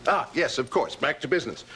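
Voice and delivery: plain reading voice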